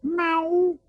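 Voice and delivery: high, loud meow; high cat meow. Shown as a demonstration. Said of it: "el gato".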